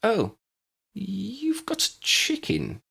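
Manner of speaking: high, slow surprised voice; surprised neighbour. A narrator's reading. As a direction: notice the chicken with high surprise.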